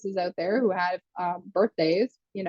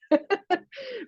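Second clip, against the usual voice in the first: giggle; 9 semitones higher than usual. Real, not acted. Laughs at own joke.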